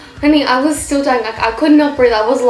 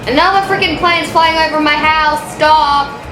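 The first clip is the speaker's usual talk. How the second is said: exasperated outburst, pitched up; real exasperation; 5 semitones higher, 3 dB louder than usual. Real, not acted. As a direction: break off to whine about the noise. Real interruption by plane noise.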